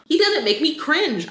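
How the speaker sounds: high, drawn-out delighted protest; excited. Real, not acted. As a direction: gush about the voice actor. Excited reaction to narrator's voice.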